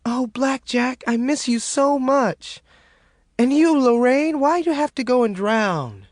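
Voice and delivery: high, tearful plea to the dead; crying into the pillow. A narrator's reading. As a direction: voice of a crying woman missing loved ones. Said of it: "she cried into her pillow".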